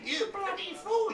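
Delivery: a budgie shrieking curses from its cage. Retold or imitated. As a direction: squawk it like an angry budgie. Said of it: "it would be shrieking".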